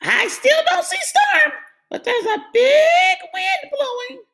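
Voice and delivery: very high, loud duck call. A narrator's reading. Duck calls down from the window. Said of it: "Duck called down again".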